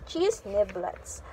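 plain reading voice

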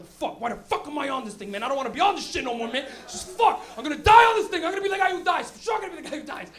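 a rider panicking as he's buckled into Drop Zone; mock panic. Panic on the ride, building.

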